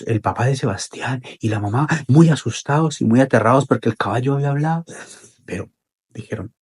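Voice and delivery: ordinary delivery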